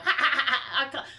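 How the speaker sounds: laughing as she recalls the friend's cackle. Real, not acted. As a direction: breaks off mid-line into real laughter. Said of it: "I can't even replicate it".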